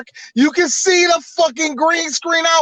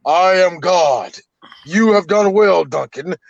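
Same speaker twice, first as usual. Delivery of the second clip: deep, godlike impression, drawn out; James Earl Jones; 4 semitones lower than usual. Playing God congratulating Duncan, low solemn voice. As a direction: proclaim it low and stately. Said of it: "James Earl Jones go".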